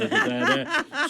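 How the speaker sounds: breaks into high laughter mid-word; laughing. Real, not acted. Guest laughing while retelling a compliment.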